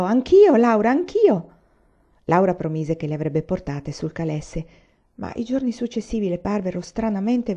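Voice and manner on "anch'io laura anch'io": put-on high, loud child's cry of me too; little sister shouting to be included; excited shout. Grace begs Laura for a buggy ride, shouting eagerly. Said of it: "E Grace gridò".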